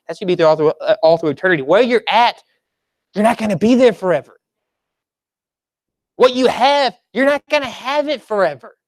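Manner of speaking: normal talking voice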